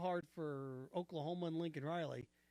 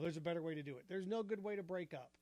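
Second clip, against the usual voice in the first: usual speaking voice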